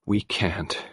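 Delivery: quiet, hollow resignation; empty and hopeless. A narrator's reading. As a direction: say it softly, all hope gone. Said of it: "His voice was empty and hopeless".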